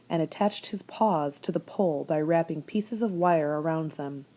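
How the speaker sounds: ordinary delivery, nothing marked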